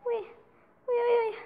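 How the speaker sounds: high little yelps; startled squeals. Real, not acted. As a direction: yelp with surprise.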